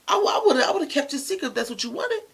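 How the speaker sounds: high, loud, hurt plea; hurt outburst. Retold or imitated. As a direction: burst out with hurt and disbelief. Hurt friend pleading, voice rising.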